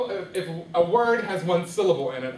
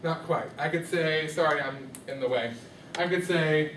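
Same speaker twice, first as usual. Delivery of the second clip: ordinary talk, nothing marked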